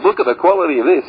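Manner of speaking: hammed-up salesman hype; imitating a glossy TV commercial; mock ad voice. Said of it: "when you see those ads saying".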